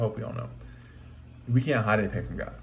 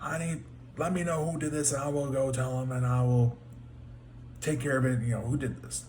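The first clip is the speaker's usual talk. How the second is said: a retold king pressing hard for the culprit; outraged; 5 semitones higher, 3 dB quieter than usual. David demanding to know who did it, in mock outrage. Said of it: "he's outraged".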